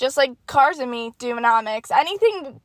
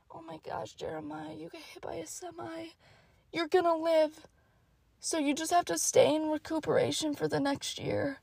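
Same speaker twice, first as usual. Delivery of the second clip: very soft, near-whispered reassurance; hushed bedside voice; 4 semitones higher, 11 dB quieter than usual. Pretend. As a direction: speak softly as if beside a hospital bed. Hushed talk at imagined hospital bedside.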